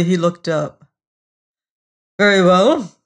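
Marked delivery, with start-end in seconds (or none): 2.2-2.9 louder, brisk agreement. A narrator's reading. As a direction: settle it in a businesslike way. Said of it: "he said briskly".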